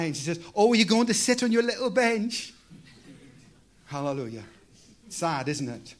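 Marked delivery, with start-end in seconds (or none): 0.6-2.5 teasing mock. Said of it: "was mocking me the other day".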